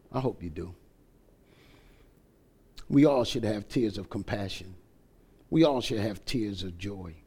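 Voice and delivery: low, hushed pulpit appeal; solemn preaching. Real, not acted. Preacher asking the church about tears, solemn and low. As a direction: bring it right down, with weighty pauses.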